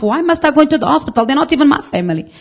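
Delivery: high, quick, put-out protest; grumbling to herself. Retold or imitated. Woman woken at six complains to herself, quick and high. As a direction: whine it fast, annoyed at being sent. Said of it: "I was talking to myself".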